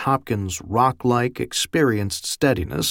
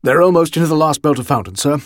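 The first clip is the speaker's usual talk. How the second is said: brisk, louder, higher sergeant's report; respectful; 4 semitones higher, 6 dB louder, much faster than usual. A narrator's reading. Platoon sergeant prompting his lieutenant, pointedly respectful. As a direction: report up to an officer, fast and loud. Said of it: "tone couldn't have been more respectful".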